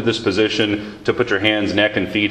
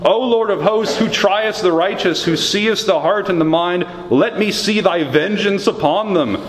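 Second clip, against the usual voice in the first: higher, impassioned reading of the lament; lamenting scripture reading; 10 semitones higher than usual. Acted. Pastor reads Jeremiah's private lament to the Lord, impassioned. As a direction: pour out the complaint as you read.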